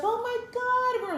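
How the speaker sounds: high, drawn-out friend's squeal; excited greeting. Retold or imitated. Former colleague greeting an old friend, imitated excited squeal.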